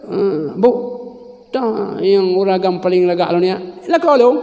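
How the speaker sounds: lightly voiced husband; playful husband voice. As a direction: tease your wife in a high voice. Husband asking his wife.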